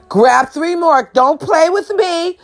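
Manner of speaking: high, slow, stretched-out mimicry; imitated insistent demand. Neighboring diner ordering boxes, mimicked insistent demand.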